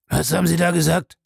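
quick, startled question; utterly baffled. A narrator's reading. Abel reacts to the butler's French, baffled. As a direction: ask it fast, taken aback. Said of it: "fragte Abel völlig verblüfft".

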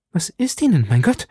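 high-pitched worried question; alarmed concern. A narrator's reading. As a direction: exclaim in startled concern for her. Erschrockene Frage nach Miladys Schrei. (erschrocken besorgt, mit hoher Stimme)

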